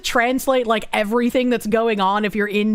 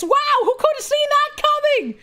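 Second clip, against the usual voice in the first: mock amazement. Sarcastic reading of chat comment.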